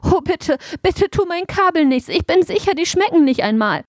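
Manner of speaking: high-pitched, startled begging; scared little truck begging for mercy; frightened pleading. A narrator's reading. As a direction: plead in alarm, voice jumping up high. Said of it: "Erschrocken rollte Macy zurück".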